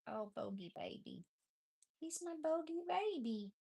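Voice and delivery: high, loud cooing pet talk; doting high pet voice. Real, not acted. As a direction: gush over the kitten. Cooing at kitten Bogart.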